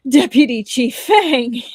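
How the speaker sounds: loud, slow frightened greeting; startled cry. Acted. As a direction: blurt the name in panic, forcing cheer. He forced a ghastly smile.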